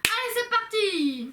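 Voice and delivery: high, drawn-out rallying call; excited kickoff. Real, not acted. Friend starting the headphone game, excited. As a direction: launch the game like a show host.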